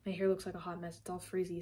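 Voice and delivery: normal talking voice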